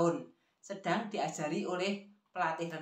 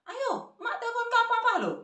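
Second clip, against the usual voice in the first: high, louder granny threat; acted high-pitched nagging grandma; threatening grandmother; 5 dB louder than usual. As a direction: warn the child in a high, raised voice. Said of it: "dia menyambung lagi bicara".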